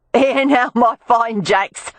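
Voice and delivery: put-on playful Cockney tart calling to two drunken men. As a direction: hail the brawlers like a Cockney streetwalker. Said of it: "called out in a Cockney accent".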